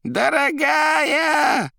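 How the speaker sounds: very high, long-drawn rattle; Koroviev's jangling voice. A narrator's reading. Koroviev addressing Margarita, jangling drawn-out cry. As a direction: stretch the word out high and loud. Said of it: "дребезжал Коровьев".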